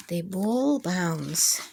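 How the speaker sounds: slow, clear storybook reading; reading to children. Acted. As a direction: read it out slowly for little ones. Reading picture book title slowly.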